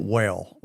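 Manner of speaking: "whale" said as Southern whale, long ay vowel. Shown as a demonstration. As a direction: say "whale" with a West Virginia drawl. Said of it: "we call it whale".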